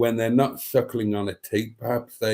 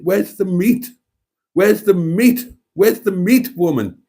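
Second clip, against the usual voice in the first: high, loud, indignant demand; mock outrage; 9 semitones higher, 6 dB louder than usual. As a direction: demand it in exasperated disbelief, pitch leaping up. Mock outrage at meatless lunch.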